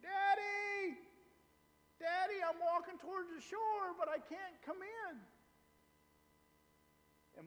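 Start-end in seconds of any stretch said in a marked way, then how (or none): 0.0-5.1 high-pitched cry to dad for help; screaming child. Retold or imitated. Daughter calls dad from rough surf, screaming. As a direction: imitate a panicked daughter screaming from the water. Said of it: "starts screaming".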